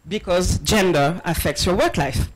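ordinary talk, nothing marked

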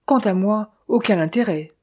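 ordinary delivery, nothing marked

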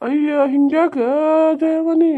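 high, loud appeal; pleading protest. Acted. Husband protests at wife's scolding. (ಗೋಗರೆಯುವ ಏರಿದ ಧ್ವನಿ)

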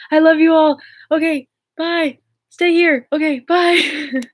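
high, loud, giddy farewell; excited goodbye. Real, not acted. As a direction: call it out high and loud to your fans. Excited goodbye to the live viewers.

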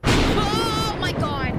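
loud, high, drawn-out cry; frightened scream. Real, not acted. Jump scare.

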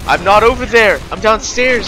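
yelling across the room. Real, not acted. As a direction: shout it to a far room. Answering teammate yelling his name.

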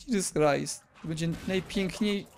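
ordinary delivery

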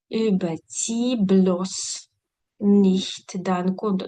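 very slow, deliberate German imperative; very slow demo read. Shown as a demonstration. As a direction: drag the example out word by word. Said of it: "imperative example, very slow". (дуже повільно, по складах)

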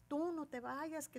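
lightly imitated supportive ladies; chorus of women. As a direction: gently mimic women taking someone's side. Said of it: "todas las señoras".

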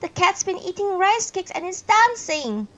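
put-on high, loud excited shout of kids; loud children's shout. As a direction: call it out like excited children spotting something. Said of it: "who loudly shout".

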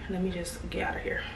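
breathy whisper under the breath. Real, not acted. Vlogger muttering to herself as she leaves, whispered. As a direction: whisper to yourself, fed up.

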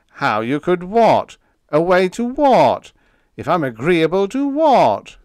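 high, loud exasperated questions; pettish. A narrator's reading. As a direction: draw out each 'what' in irritation. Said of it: "he ordered pettishly".

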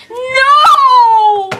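high, loud shocked yelp; shocked scream. Acted. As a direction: shriek in horror. Pants fall down.

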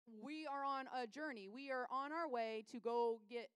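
slow, loud, word-by-word insistence; exasperated insistence. Acted. Desperate father to Jesus who has stopped, exasperated. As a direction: spell it out impatiently, loudly.

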